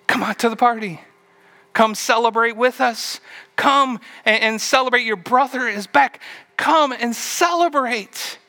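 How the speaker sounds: high, coaxing father's plea; pleading father. Acted. Father pleading with his son to join the party. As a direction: coax him to come in. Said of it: "What was he pleading about?".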